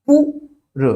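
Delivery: पुर high, with a long oo; long vowel. Shown as a demonstration. Teacher showing the vowel length, long oo in पुर. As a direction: stretch पुर into a high poor. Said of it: "बड़ा ऊ होगा".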